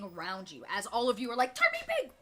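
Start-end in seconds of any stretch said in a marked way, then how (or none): 1.5-2.1 high, loud squeals of urgency; frantic pleading. Acted. Game master voices the party scrambling into position, frantic. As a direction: clamour in a raised, squeaky voice.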